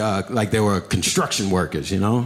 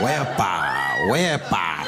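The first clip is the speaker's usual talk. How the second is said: dance call; 3 semitones lower, much slower than usual. Pretend. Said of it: "that little Spanish dance".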